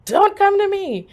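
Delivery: high, loud, drawn-out warning; emphatic shout. Retold or imitated. Daughter warning mom away over the phone, insistent shout. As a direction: shout it slowly so she obeys.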